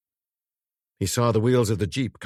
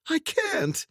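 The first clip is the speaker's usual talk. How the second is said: horrified whisper. A narrator's reading. Corinne refusing horrified.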